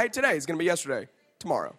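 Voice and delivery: real quick. Shown as a demonstration. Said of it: "tell them that real quick".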